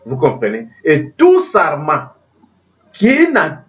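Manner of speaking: usual speaking voice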